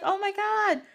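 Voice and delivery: very high, mock-amazed kid voice; imitated boy's exclamation. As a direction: squeal it lightly as the boy. Voicing the boy's reaction.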